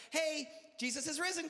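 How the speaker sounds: high, bright-eyed church greeting; excited kid greeting. Retold or imitated. Little boy proudly greeting the church crowd, high excited. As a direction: chirp it out like an eager kid.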